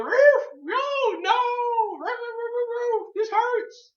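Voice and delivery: very high yelping dog cries; high-pitched pained dog voice; whimpering dog voice. Retold or imitated. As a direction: squeal it like a hurt puppy. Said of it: "It's like".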